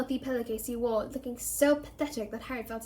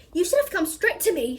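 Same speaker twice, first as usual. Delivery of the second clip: matron scolding sharply, higher and louder; raging; 6 semitones higher, 5 dB louder than usual. A narrator's reading. Nurse scolds the injured boy, raging. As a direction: tell the boy off hotly for waiting. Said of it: "she raged".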